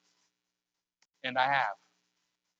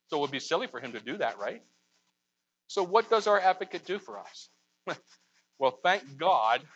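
normal talking voice

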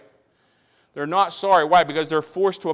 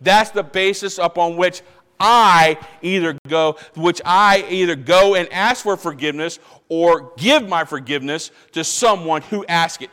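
ordinary talk, nothing marked

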